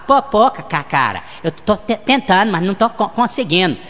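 slow stammer on each syllable; stuttering Gaguinho. Acted. Friend on the phone stammers a greeting. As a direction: trip over the first syllable, then push through. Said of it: "O Gaguinho".

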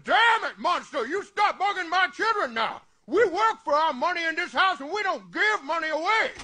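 stern fatherly yell; angry scolding. Acted. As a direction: run the monster out of the house. Scolding monster in son's room.

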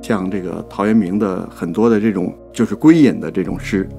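ordinary delivery

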